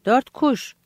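"Dört kuş" high, rising at the end; short, lifted echo, stress on "dört"; impressed repetition. A narrator's reading. Mizek repeats the catch, pleased.

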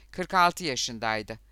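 ordinary delivery, nothing marked

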